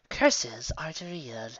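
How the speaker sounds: slow, nose-in-the-air scorn; haughty dismissal. Acted. Fast Volume sneering at Trixie, lightly snobbish. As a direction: dismiss it with contempt. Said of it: "turning her nose up".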